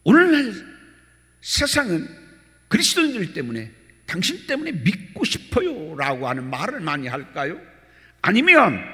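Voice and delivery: loud, high, fervent sermon; preaching at full force. Acted. Pastor exhorting his congregation, loud and high. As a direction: exhort the congregation to examine themselves.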